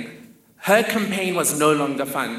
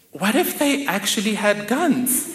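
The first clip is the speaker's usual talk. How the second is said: higher, laughing disbelief; laughing question; 8 semitones higher than usual. Retold or imitated. Cousins asking the mother about the toy gun, laughing. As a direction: ask it half laughing, incredulous. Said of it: "My cousins and I say to her".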